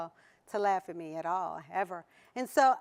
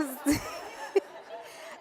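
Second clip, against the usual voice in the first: silent wheezing laugh; airy wheeze of laughter; breathless laugh; 3 semitones higher, 6 dB quieter than usual. Real, not acted.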